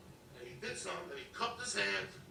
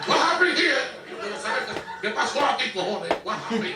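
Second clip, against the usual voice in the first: hammed-up Puerto Rican accent, pitched up high; Spanish-accented broken English; 7 semitones higher, 3 dB louder than usual. Stepdad confronting the cab driver, in a high, loud Spanish accent. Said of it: "He spoke, like, broken English".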